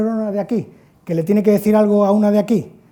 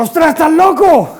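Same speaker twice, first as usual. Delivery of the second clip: louder and higher, mock-shocked cry; shocked exclamation; 8 semitones higher, 9 dB louder than usual. Teen telling his friend he's crazy, loud mock shock. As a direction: blurt it out like you can't believe it. Speaker reacts to friend's stunt.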